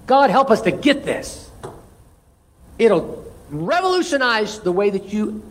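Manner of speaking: ordinary delivery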